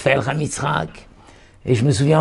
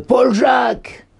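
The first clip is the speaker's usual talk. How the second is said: higher, slow, called-out offer of "Paul-Jacques"; mock clerk suggestion; 8 semitones higher, slower than usual. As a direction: play the clerk pitching a name brightly. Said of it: "Alors elle lui dit".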